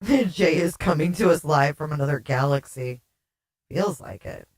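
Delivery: ordinary delivery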